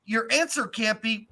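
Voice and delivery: ordinary talk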